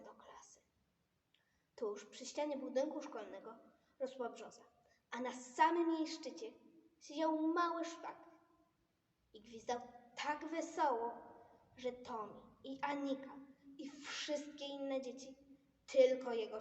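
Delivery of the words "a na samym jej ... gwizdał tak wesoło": ordinary delivery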